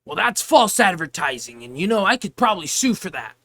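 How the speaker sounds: loud, mocking huffy customer threatening to sue; entitled customer. Cashier mimicking the customer threatening to sue, loud and huffy. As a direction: ham up the customer's outrage. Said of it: "He's like".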